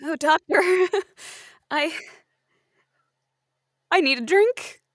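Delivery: ordinary talk, nothing marked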